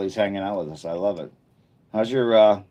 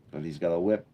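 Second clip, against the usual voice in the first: put-on Australian accent; 2 semitones lower, faster than usual. Streamer describing a rugged game character, mock Australian accent. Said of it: "That's why I'm doing the accent".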